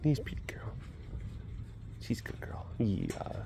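unvoiced whisper of praise. Real, not acted. Praising the tiger in a whisper. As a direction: whisper sweetly to the cat.

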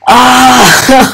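high drawn-out fan squeal; excited squeal. Real, not acted. Fan meeting favourite YouTuber, excited squeal. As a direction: let out a high, thrilled cry.

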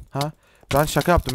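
ordinary delivery

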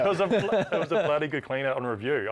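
real laugh breaking into the words. Real, not acted. Referee admits to player it was a good clean out, laughing. Amused, agreeing with player's teasing remark.

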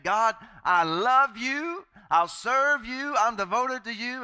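slow, high, pleading prayer voice; mock a devout believer praying, drawn out; earnest believer. Christians praying to God.

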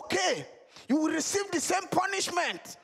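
high, disbelieving uncle; shocked uncle voice. Acted. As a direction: sound appalled at another thief. Uncle sets the punishment.

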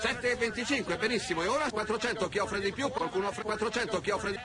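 rapid rhythmic bid calling; italian auctioneer. Acted. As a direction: rattle off the bids like an auctioneer. Auctioneer calls the bids.

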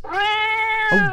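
high "Oh dear" of surprise; startled, squeaky dismay; surprised gasp. Acted. Surprise at the cat.

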